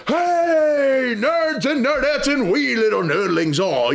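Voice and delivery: high-energy intro shout with a drawn-out hey; big, high-pitched hype greeting, imitating a host's opener; Big Johnny G. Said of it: "he starts off with this high energy".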